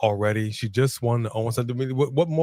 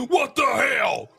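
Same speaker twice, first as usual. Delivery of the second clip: shocked shout; 11 semitones higher, slower than usual. Real, not acted. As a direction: yell it in disbelief. Reacting to chair shot.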